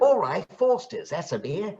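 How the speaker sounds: high-pitched Australian, placed further back; Australian accent. Shown as a demonstration. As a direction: try out an Australian ad-style line. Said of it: "So it's like".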